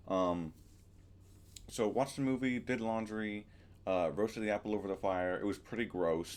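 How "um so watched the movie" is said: normal talking voice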